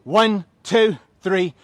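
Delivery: loud, high count-off over the music; energetic coach calling reps; shouted counts. Real, not acted. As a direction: bark the reps out to the class.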